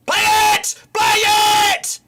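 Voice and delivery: high, loud frustrated yell; furious shout. Real, not acted. Fan screaming at his team's players to pass.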